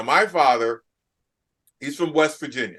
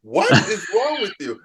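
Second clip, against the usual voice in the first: put-on slower, higher, incredulous kids; exasperated kids; 6 semitones higher, 5 dB louder than usual. Kids reacting to their dad's country sayings, incredulous and a little higher. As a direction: imitate kids staring at their weird dad. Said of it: "my own kids look at me like".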